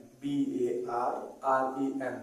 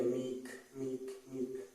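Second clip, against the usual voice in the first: meek softly; 11 dB quieter, much slower than usual. Shown as a demonstration. Teacher says meek softly, drawn out.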